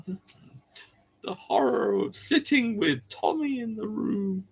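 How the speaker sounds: high, drawn-out mock dread; hammed-up, slow high-pitched horror. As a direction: draw it out slowly in feigned horror. Mock horror at playful punishment.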